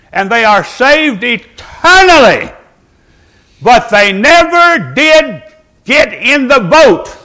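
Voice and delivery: slow, drawn-out preaching cadence; a revival preacher building the point; fervent preaching. Acted. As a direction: preach it slowly, leaning on each phrase. Preaching at full force.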